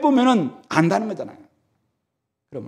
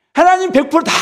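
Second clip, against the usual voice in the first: much higher, louder proclamation; ringing declaration; 11 semitones higher, 5 dB louder than usual. Real, not acted. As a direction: announce the full answer triumphantly. Climactic declaration. (힘차게 선포하는 목소리)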